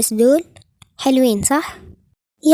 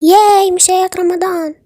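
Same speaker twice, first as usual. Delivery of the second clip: higher, louder delighted yay; excited cheer; 5 semitones higher, 5 dB louder than usual. Acted. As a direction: burst with joy. Daughter cheering.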